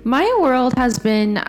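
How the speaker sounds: ordinary talk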